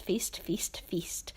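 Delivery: whispered, dying away; soft whispered echo; fading whisper. Acted. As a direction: trail off into a whisper. Repeated feast fading out.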